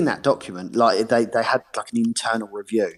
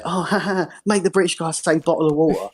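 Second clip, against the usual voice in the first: mock laughing jeer, higher-pitched; mocking Americans; 6 semitones higher than usual. British guy mimicking Americans who tease him, mocking. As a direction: imitate teasing Americans, laughing falsely. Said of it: "that Americans say".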